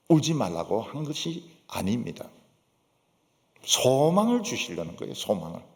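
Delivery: ordinary talk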